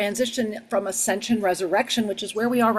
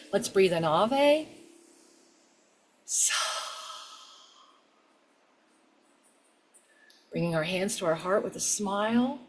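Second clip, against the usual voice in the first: soft, unhurried meditation voice; guided meditation; 3 dB quieter than usual. Acted. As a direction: guide them gently inward.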